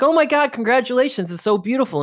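high, gushing mock congratulations; gushing townsfolk. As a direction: gush like an overexcited neighbour. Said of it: "everyone's like".

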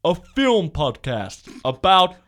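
slow booming announcer voice; dramatic trailer read; movie trailer voice. Pretend. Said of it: "do my movie voice".

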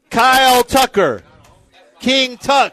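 drawn-out emcee call; calling out names. Real, not acted. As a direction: announce players for the crowd to cheer. Calling names for crowd applause.

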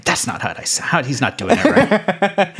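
imitating an offended voice actor; half-laughing mock protest; mock objection. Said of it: "as soon as the voice actors hear this".

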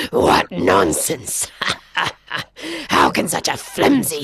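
scornful lion. Acted. Lion stalking the boy, low and scornful. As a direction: sneer it down at the boy.

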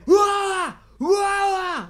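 2: high, loud wailing "Wah!" shouts; 3: yell "Wah!" like a man trying to scare off a bear; 1: imitated desperate yelling. 4: retelling a man scaring a bear: high, loud mock wails. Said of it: "Like this".

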